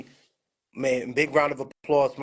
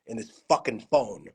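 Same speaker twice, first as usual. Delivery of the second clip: usual speaking voice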